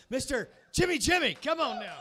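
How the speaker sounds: loud, high stage introduction; announcer call. Real, not acted. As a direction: bring him up with big energy. Host calling next comic.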